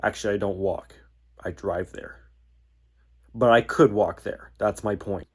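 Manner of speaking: ordinary talk, nothing marked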